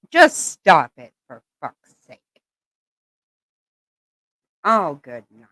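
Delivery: ordinary talk, nothing marked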